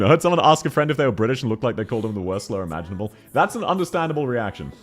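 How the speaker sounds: ordinary delivery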